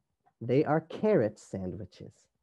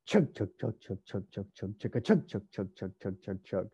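rhythmic chugging like an engine; low, even chant with accented beats; train imitation; 5 semitones lower, 4 dB quieter, faster than usual. Storyteller plays the train starting, rhythmic chugging.

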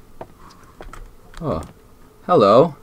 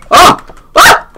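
rising loud panicked no's into screams; terrified screams; 8 dB louder than usual. Real, not acted. Player caught by the ghost, screaming. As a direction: scream in real terror.

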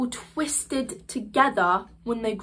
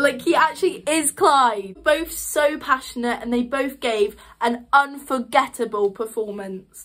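ordinary talk, nothing marked

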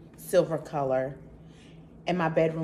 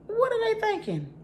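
much higher, incredulous squeal; can't believe that price; shocked high outburst at the price; outraged disbelief. Real, not acted.

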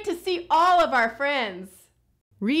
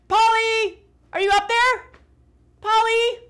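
high, loud call across the room; 9 semitones higher, 8 dB louder than usual. Pretend. Host calling loudly for the hidden Polly puppet. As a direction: call out to find the hiding puppet.

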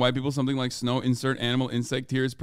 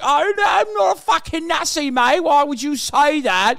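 put-on high, loud British lad accent, slowing at the end; mock British accent; 11 semitones higher, 5 dB louder than usual. Mocking EDL members on television, in a British accent. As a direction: play the offended British nationalist on television. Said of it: "they're like".

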